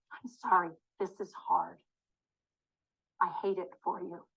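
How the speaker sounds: hushed, tender commiseration; gentle sympathetic apology. Shown as a demonstration. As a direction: offer quiet sympathy, unhurried.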